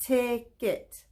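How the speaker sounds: ticket said in two beats, TICK-ET; clapped syllables. Shown as a demonstration. Teacher to kids, clapping out the syllables. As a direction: split ticket into its two claps. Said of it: "Let's count them with our fingers".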